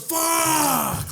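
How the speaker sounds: very high, long mock scream; blood-curdling yell. Customer screaming at the news of no chalupas, blood-curdling. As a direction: scream it like total despair. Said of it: "blood curdling fuck".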